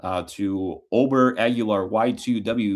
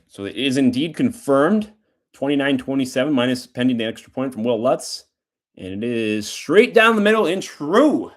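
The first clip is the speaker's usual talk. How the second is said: live play-by-play call; 2 semitones higher than usual. Real, not acted. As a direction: ring out "straight down the middle and true". Calls the extra point live.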